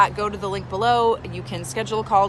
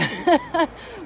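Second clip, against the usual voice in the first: laugh; 10 semitones higher than usual. Real, not acted. Laughs at no boyfriend.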